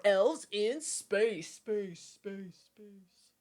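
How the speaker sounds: slow trailer voice echoing away into silence; echoing space. Pretend. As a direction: Elves in space, each space fading into the distance. Trailer-style echo fading.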